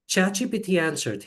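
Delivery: ordinary talk, nothing marked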